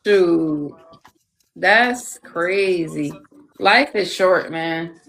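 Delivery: usual speaking voice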